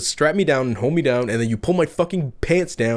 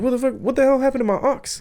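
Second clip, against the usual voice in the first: freaking out; 10 semitones higher, faster than usual. Retold or imitated. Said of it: "he started freaking out".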